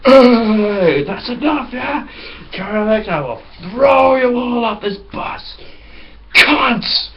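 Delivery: hammed-up furious threat; angry man on the bus. Suited passenger threatening the pranksters, mock furious shout.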